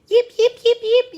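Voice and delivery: very high, loud yips; fox yelping. A narrator's reading. Storyteller imitates the chased fox, yipping high. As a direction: yelp like a fleeing fox. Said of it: "hizo el zorro".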